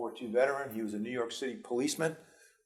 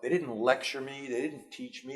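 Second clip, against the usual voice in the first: usual speaking voice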